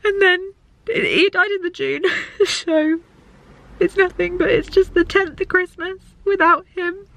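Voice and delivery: high, tearful voice; real crying. Real, not acted. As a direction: talk through real tears about a loss. Crying over her father's death.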